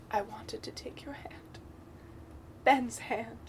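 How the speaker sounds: breathy whispered movie line; whispered quote. Retold or imitated. YouTuber quoting Rey in a whisper. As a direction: hush it like Rey's confession.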